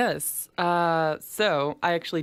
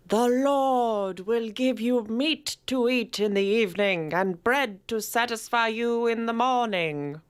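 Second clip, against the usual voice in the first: put-on scripture read in a British accent, higher and slower; slow, lofty British accent; 4 semitones higher than usual. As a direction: recite it like an English vicar reading the Bible. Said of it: "you said it with a British accent".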